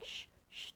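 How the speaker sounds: soft voiceless shushing; hushing. Retold or imitated. Housemate hushing her son, soft imitated shush. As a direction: imitate someone hushing a boy. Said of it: "she's going".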